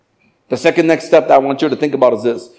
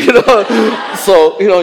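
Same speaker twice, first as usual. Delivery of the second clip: laughing; 6 semitones higher, 5 dB louder, slower than usual. Real, not acted. Laughs loudly at own line.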